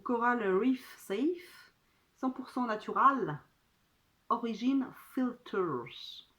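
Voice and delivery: drawn-out, showy English accent. Shown as a demonstration. Reading a sunscreen label to viewers, showy English accent. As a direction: read the label slowly in a put-on English accent. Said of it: "Vous aimez mon accent anglais".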